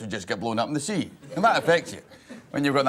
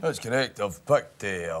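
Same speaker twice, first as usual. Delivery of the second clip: ordinary delivery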